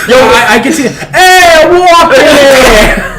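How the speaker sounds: put-on loud Boston accent Batman call. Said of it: "trying to do his Boston accent".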